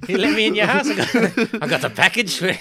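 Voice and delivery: a cartoon killer at the door; mock Ripper voice. Mocking the Ripper at the victim's door, high and laughing.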